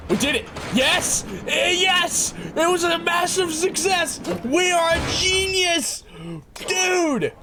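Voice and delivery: high-pitched victory yells; triumphant shouting. Real, not acted. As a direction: crow over a huge win. Train finally works, celebrating.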